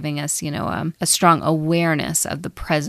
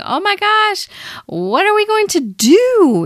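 much higher, louder frantic voice of a panicking ego; 13 semitones higher, 4 dB louder than usual. Acted. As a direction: squeal it like the ego freaking out. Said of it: "the ego just starts freaking out and panicking and saying".

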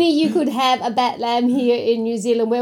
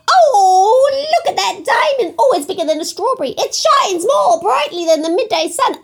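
much higher, excited hen voice; excited chicken voice; 8 semitones higher, 4 dB louder than usual. Acted. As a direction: squeal it like a thrilled chicken. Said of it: "you don't speak chicken, I'll translate".

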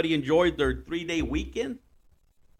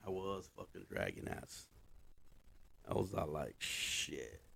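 groggy low mumble; 9 semitones lower, 15 dB quieter than usual. Retold or imitated.

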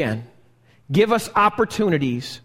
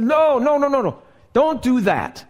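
higher-pitched, rapid string of warnings; urgent pleading God; 5 semitones higher than usual. Retold or imitated. God warns straying Israel away from destruction, urgent pleading. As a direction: plead as if stopping someone. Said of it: "And he said".

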